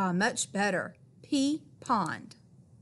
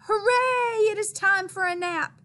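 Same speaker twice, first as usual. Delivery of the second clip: much higher, louder cheer; 9 semitones higher, 3 dB louder than usual. A narrator's reading. Reading to kids, tired Dog cheers for his nap. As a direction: cheer like bedtime is the best news.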